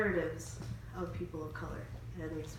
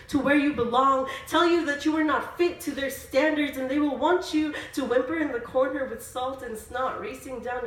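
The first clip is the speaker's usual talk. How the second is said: higher, rhythmic spoken word delivery; spoken word poetry; 6 semitones higher, 8 dB louder than usual. Acted. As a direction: perform it as an emphatic poem. Performs a spoken word poem.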